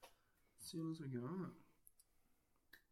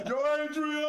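mock film shout; 12 semitones higher, 7 dB louder than usual. Podcaster going down shooting advertisements, hammed-up shout. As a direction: holler it as an over-the-top movie catchphrase.

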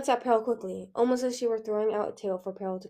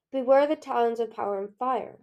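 usual speaking voice

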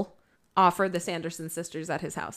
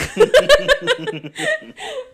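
big laugh; 7 dB louder than usual. Real, not acted. Laughs at the funny line.

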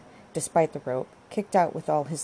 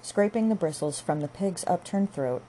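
plain reading voice

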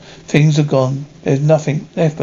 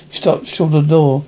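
ordinary delivery

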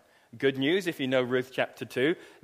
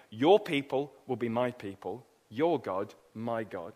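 quiet vow; 4 semitones lower, 5 dB quieter than usual. Retold or imitated. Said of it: "Ruth says to Naomi".

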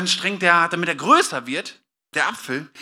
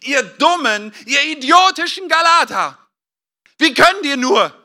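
louder, higher, scolding the Galatians; angry rebuke; 7 semitones higher, 8 dB louder than usual. Retold or imitated. Paul scolding the foolish Galatians, angry and loud. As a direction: tell them off as fools. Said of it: "übersetzen mit".

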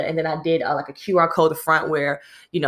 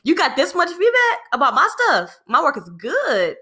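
much higher, louder, laughing what; indignant surprise; 10 semitones higher, 4 dB louder than usual. Retold or imitated. Picturing the boss's feedback, high indignant what. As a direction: play up the outrage.

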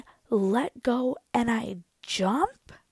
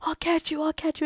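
high-pitched mock dad; lightly voiced dad, pitched up; dad calling out; 8 semitones higher than usual. Dad offers to catch the climber, calling out.

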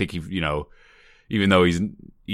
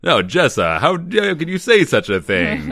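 mock outrage; 6 semitones higher, 5 dB louder than usual. Mock scolding the dummy.

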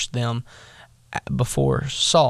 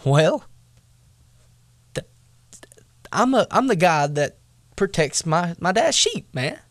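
put-on higher, protesting young David; indignant David; 5 semitones higher than usual. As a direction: stand up for yourself. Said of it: "David comes back and says".

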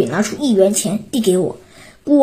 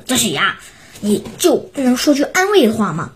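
plain reading voice

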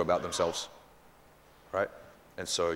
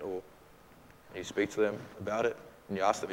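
ordinary talk, nothing marked

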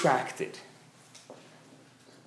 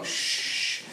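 shushing; 4 dB quieter than usual. Acted. Hushing others in line.

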